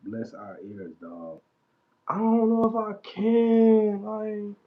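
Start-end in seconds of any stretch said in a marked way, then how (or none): none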